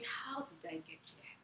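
high, soft mock despair; sad wondering. New mother wondering at her changed body, softly despairing. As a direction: wonder aloud in a small, high, sorrowful voice. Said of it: "I was just sad, wondering".

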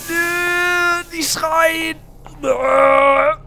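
loud, high, stretched-out cry; long high howl of refusal; panicked wail. Real, not acted. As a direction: wail it in terror. Panics at screaming in game.

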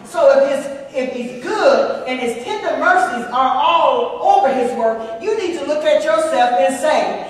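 loud, rising preacher cadence to the congregation; preaching at full force. Acted. A preacher telling the congregation God's mercies cover them, loud and fervent. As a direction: drive it home to the congregation.

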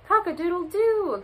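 high crowing cry; rooster's crow. A narrator's reading. Rooster alarmed at the sky, crowing high. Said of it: "cried Rooster".

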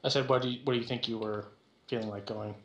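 normal talking voice

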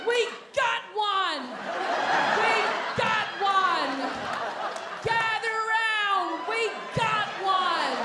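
high, slow, triumphant mob cry; mock witch-hunt crowd. Waitresses parading him around, crowing in a high mock cry. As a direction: cry it out like villagers who caught a witch. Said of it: "They're just like".

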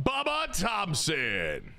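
stretched-out player announcement, falling away; drawn-out name call. Real, not acted. Streamer announcing his batter, long and drawn out. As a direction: draw the batter's name right out.

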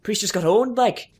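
put-on northern English accent. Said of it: "this is a northerner voice".

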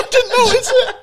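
high, loud laughing wail; crying with laughter. Real, not acted. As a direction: squeal it through tears of laughter.

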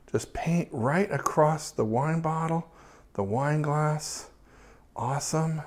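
speaking softly, almost under breath during the shot; hushed talk. Real, not acted. Photographer murmurs to himself during a long exposure, hushed. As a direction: keep it hushed so you don't disturb the exposure.

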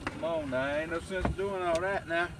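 ordinary talk

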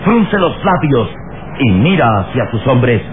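ordinary talk

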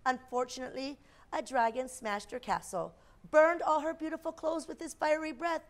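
usual speaking voice

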